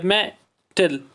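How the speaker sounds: "Metal" with a break, मे, टल; syllable by syllable. Shown as a demonstration. Teacher explaining a sound break to children, syllable by syllable. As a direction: pause between the two syllables of "metal". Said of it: "अगर sound break ना हो".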